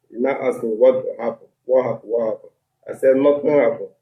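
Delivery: ordinary talk, nothing marked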